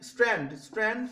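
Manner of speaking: strand repeated carefully for the class; rhyme word demo. Shown as a demonstration. Teacher sounding the rhyme word স্ট্র্যান্ড for students. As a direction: say স্ট্র্যান্ড twice, sounding out the str and the final nd. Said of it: "শেষের ওয়ার্ডটা আছে স্ট্যান্ড".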